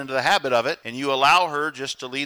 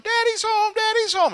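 put-on much higher, gleeful little-kid chant; excited kids. Preacher imitates kids greeting dad, high excited chant. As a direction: squeal it like thrilled children at the door. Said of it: "instead of the kids going".

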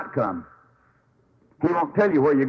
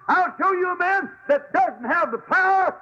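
much higher ringing preacher cry; preacher exalting the Bible to the congregation; preaching at full force. Acted. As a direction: proclaim it to the back row. Preaching climax about the book.

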